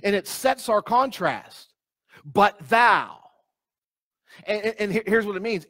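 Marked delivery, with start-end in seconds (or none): 2.2-3.2 firm, punched "thou." with a falling tune; emphatic THOU. Shown as a demonstration. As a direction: land hard on "thou." as a contrast. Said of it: "It's emphatic".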